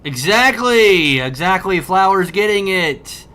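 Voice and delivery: loud, slow triumphant cheer; loud delighted agreement; excited shout. Real, not acted. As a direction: cheer that someone finally gets it. Cheering a chat viewer.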